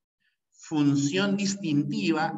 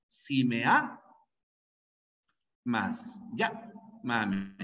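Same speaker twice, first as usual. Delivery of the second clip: "amas" and "llámame" slowly broken up to show their stresses; slow, low demo stressing A in "amas", LLÁ in "llámame"; two forces of voice; 5 semitones lower, 4 dB quieter, slower than usual. Shown as a demonstration. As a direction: split "amas" after its strong A and "llámame" after LLÁ. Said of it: "ahí hay dos fuerzas de voz".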